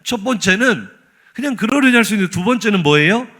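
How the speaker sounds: ordinary talk, nothing marked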